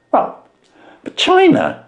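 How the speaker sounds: a plain man dumbfounded by the trick; Wilson voiced.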